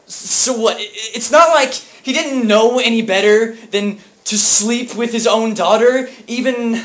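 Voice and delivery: loud, high, stammering outburst; anguished anger. Acted. Brother raging at what his father did, anguished anger. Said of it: "grieving over an unbearable past".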